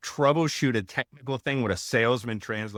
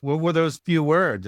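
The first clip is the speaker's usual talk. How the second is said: put-on pitched-up, louder child's question; imitating his kid; 8 semitones higher, 5 dB louder than usual. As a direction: play the curious kid, higher and louder. Kid asking dad.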